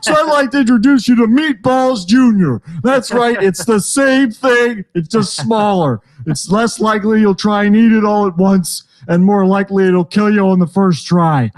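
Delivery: hammed-up TV sales pitch; punchy mock pitchman; infomercial pitchman. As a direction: sell it like a late-night product ad. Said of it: "If you needed this infomercial".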